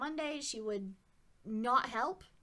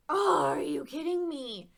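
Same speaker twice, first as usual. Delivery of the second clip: louder, slow exasperated disbelief; 5 semitones higher, 7 dB louder than usual. Retold or imitated. To the general manager, slow louder disbelief. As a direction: drag it out in disbelief.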